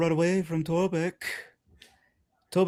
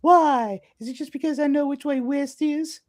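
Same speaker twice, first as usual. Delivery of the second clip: incredulous character; 9 semitones higher than usual. Retold or imitated. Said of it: "my favorite one is just like".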